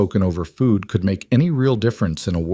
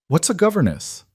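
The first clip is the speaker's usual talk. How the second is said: put-on higher, curious child; eager young girl asking; little girl voice; 9 semitones higher than usual. A narrator's reading.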